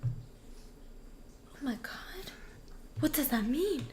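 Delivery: slow, stunned whisper. Real, not acted. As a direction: breathe it out in shock. Spooked reaction.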